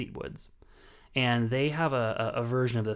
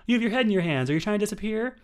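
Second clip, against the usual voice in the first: much higher, louder voice teasing the actress; mock interviewer; 10 semitones higher, 5 dB louder than usual. Podcast host imitating an interviewer calling out an actress, mock interviewer. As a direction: put on a high, pushy interviewer. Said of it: "the interviewer is like".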